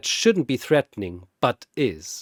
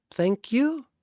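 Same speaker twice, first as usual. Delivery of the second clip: puzzled, asking more than thanking; unsure thanks; 10 semitones higher than usual. Acted. Sasha thanking Popo, high and unsure.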